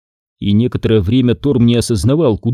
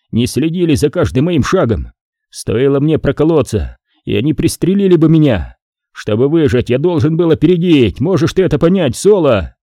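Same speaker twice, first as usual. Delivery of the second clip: desperate pleading; 4 semitones higher than usual. Acted. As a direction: beg for your life in panic. Terrified Torm begs for his life.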